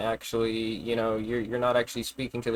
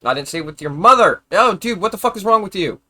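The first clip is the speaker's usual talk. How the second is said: exasperated shout; 5 semitones higher, 8 dB louder than usual. Real, not acted. Shouting back at chat comment.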